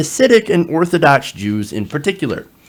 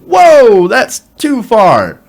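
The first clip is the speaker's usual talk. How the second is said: high, loud imitation of alarmed objectors, easing off; mock protest; 6 semitones higher, 4 dB louder than usual. Host imitating Cuomo supporters balking at the smear, mock protest. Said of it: "Cuomo supporters saying".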